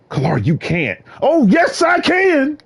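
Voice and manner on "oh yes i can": high, bold "I can"; defiant. Acted. Answering a doubter, high and defiant. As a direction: throw the doubt right back.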